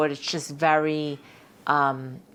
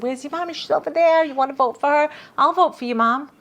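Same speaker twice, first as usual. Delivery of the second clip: put-on high, louder sing-song for children; cooing at kids; 9 semitones higher, 5 dB louder than usual. Voters cooing to the judge's children, high and bright. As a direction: talk up to little kids like a charmed adult.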